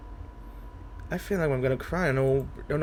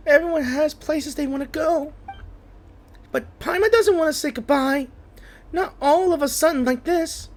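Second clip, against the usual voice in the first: put-on higher, childlike fairy companion pleading; lightly voiced Paimon, upset; 12 semitones higher than usual. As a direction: plead with Drax not to leave. Reads Paimon's lines in character.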